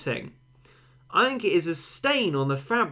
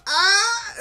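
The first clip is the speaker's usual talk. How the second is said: high, loud celebratory "Ah!"; imitated joyful yell; 7 dB louder than usual. Imitating the football manager entering, high joyful yell. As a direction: burst into the room with glee. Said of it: "He's like".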